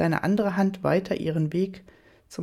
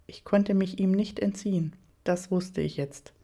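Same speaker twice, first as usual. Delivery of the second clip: ordinary delivery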